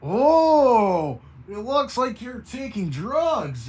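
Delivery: mock the Baroness as the cowboy. Cowboy tells Baroness she looks drugged.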